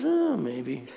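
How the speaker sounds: the farmer's even reply; calm farmer. Acted. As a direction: shrug it off like a calm old farmer. Farmer voiced in Zen story.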